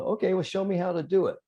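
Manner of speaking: high-pitched, quick imitation of a doubting golfer; mock challenge. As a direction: toss out a high, hurried dare. Acting out Johnny's skeptical challenge.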